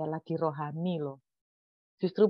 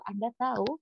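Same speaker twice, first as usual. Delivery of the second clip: ordinary talk, nothing marked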